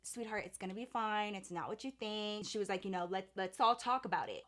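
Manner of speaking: calm her down gently like a mother; hushed motherly reassurance; soothing mom. Retold or imitated.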